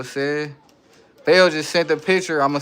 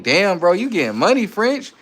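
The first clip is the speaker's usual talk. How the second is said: excited; 6 semitones higher, 5 dB louder than usual. Real, not acted. Hyping viewer's spending.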